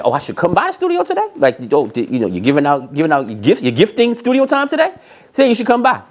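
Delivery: high, sarcastic mocking echo; scoffing sarcastic retort. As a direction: throw his words back at him, mocking. Mocking the artist's invitation.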